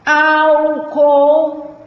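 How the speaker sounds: "álcool" high and loud, long ÁL; stress on ÁL. Shown as a demonstration. Teacher showing the tonic syllable, loud stretched ÁL in "álcool". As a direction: hold both parts of "álcool", hitting ÁL first. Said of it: "sílaba tônica é o au".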